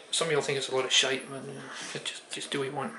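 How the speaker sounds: drowsy, fading mumble; drifting off as the words trail away; nodding off. Real, not acted. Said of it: "I'm half asleep".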